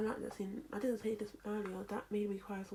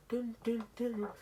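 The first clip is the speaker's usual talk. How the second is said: louder sung dun dun dun; mock dramatic music; dramatic sting; 2 semitones higher, 5 dB louder than usual. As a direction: hum a movie sound cue.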